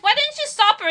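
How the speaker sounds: high, loud mock accusation; accusing parent.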